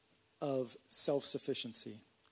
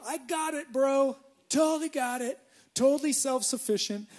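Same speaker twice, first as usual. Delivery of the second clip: high, loud, cocky brush-off; mock bravado; 8 semitones higher, 5 dB louder than usual. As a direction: play the overconfident fool refusing help. Imagined proud refusal of help.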